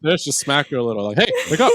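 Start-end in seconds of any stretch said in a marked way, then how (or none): 1.2-1.7 sharp wake-up call. Pretend. Mock slapping partner awake.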